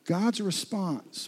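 God's response drawn out very slowly; slow, deliberate God's response; slow emphasis. Real, not acted. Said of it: "please don't miss this".

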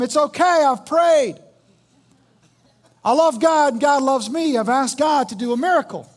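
loud, high, emphatic assurance; confident refusal. Acted. Flooded man turning down the rescue boat, loudly confident. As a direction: wave the rescuers off with cheerful certainty.

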